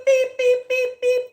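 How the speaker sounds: high, loud beep beep like a bleep tone; silly imitation of a censor bleep; mock censor beeps. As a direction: bleep out your own curses in a high pitch. Self-censoring her own insults.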